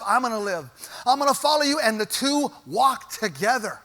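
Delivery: loud, driving sermon delivery; full-force preaching. Acted. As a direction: preach it hard and build the fire.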